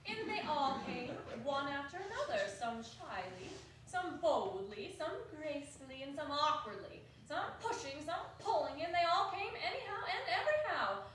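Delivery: plain reading voice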